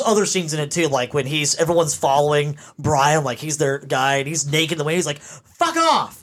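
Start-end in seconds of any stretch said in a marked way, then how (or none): 5.2-6.2 high-pitched mock yell; exasperated yell. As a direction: imitate a fed-up man's high outburst. Acting out exasperated film character.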